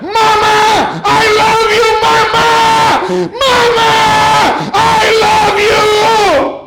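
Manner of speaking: high, loud, slow cry of love across the airport; desperate wailing call to mama; crying shout. Retold or imitated. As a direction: shout it out, voice breaking with tears. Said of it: "comenzó a gritar".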